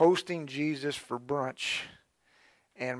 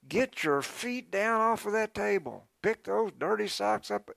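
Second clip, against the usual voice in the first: scolding housewife; 7 semitones higher than usual. Retold or imitated. Lady scolding before house guests.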